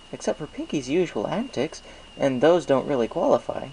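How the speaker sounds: high, friendly shopkeeper pony voice; chirpy baker pleased with business; Mister Cake's voice. A narrator's reading. Baker thanks Applejack for the apples, chirpy and friendly. Mister Cake reassures Chaser.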